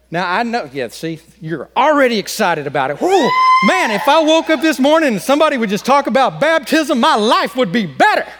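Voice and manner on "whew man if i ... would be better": mock excitement. Mock excitement about baptism topic.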